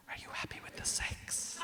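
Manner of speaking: breathy whisper with a laugh; wife's hushed intimate question. Retold or imitated.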